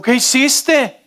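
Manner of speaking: startled dad. Retold or imitated. Father finds pool full of bubbles.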